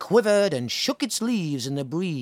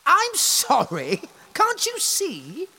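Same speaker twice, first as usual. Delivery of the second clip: higher, louder haughty tree voice; vain tree; 4 dB louder, slower than usual. Acted. The tree refusing animals, haughty and high. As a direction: sniff it out haughtily. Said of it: "said the oak tree".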